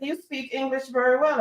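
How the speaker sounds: high-pitched imitated compliment; impressed. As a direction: mimic an amazed patient, voice lifted high. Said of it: "a lot of people are so impressed".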